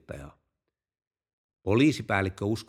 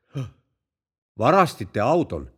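acted gasp, then a slow, loud question; astonished gasp; 5 dB louder than usual. Policeman hearing the driver stole the car, astonished gasp. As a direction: take in the confession with slow disbelief. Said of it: "henkäisi poliisi".

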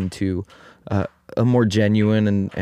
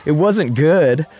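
dramatic villagers; 8 semitones higher than usual. Retold or imitated. Villagers to the farmer about his son, higher and mock-dramatic. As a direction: lament his bad luck over the top.

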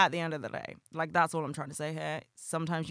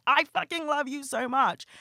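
gushing; 9 semitones higher, 4 dB louder than usual. Retold or imitated. Retold gushing to friends.

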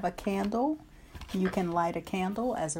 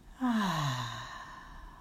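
long breathy exhaling sigh; loud sigh; 2 semitones lower than usual. Shown as a demonstration. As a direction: let the breath out in a whispered sigh. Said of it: "exhale with a loud sigh".

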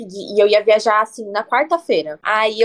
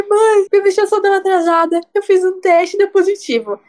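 high, tearful, raised plea to mom; retold crying; 10 semitones higher, 4 dB louder than usual. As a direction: replay the panicked tearful call, pitched high. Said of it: "Eu liguei pra ela chorando".

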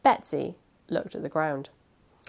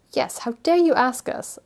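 mild indignation; 6 semitones higher, 3 dB louder than usual. A narrator's reading. Steward echoes housekeeper's indignant refusal.

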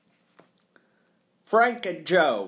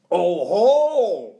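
loud, drawn-out crow of suspicion; triumphant exclamation; 4 semitones higher, 9 dB louder than usual. A narrator's reading. Accuser pounces on the caretaker's claim, exclaiming loudly. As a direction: blurt it loudly, higher and stretched out. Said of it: "exclaimed Mister Applegate".

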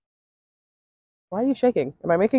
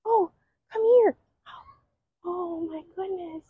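doting pet talk; 12 semitones higher than usual. Real, not acted. Begging the cow not to walk away, very high baby talk. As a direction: beg the cow not to leave, adoring.

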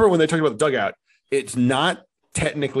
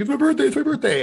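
put-on much higher, fast childish squeal; mock eager kids; 13 semitones higher, faster than usual. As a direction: bounce like excited little kids. Little kids screaming.

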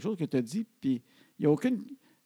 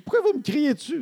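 high, loud mock outrage; indignant teen protest; 10 semitones higher, 6 dB louder than usual. Daughter asks parents why they yell, indignant mock protest. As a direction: imitate an accusing, wounded teenager. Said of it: "je trouve ça drôle comme expression".

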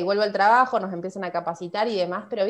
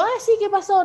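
mock innocent; 11 semitones higher, 3 dB louder than usual. Feigning innocence with the manager.

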